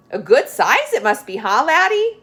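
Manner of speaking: loud, high, jovial question; jolly policeman. Acted. Policeman jokes loudly with Angus about the beast. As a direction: tease the boy heartily.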